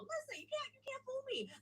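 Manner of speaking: high, soft mocking customer voice; jaded customer voice. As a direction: play a know-it-all customer.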